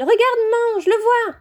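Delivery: tug at mum's sleeve with glee; excited little girl. A narrator's reading. Ginny showing her mother Harry, high excited child voice.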